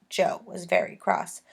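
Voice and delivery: ordinary delivery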